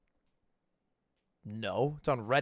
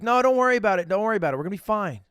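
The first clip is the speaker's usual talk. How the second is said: fake reassurance; 6 semitones higher, 6 dB louder, much faster than usual. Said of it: "Be like".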